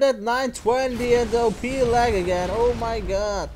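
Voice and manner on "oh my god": ordinary delivery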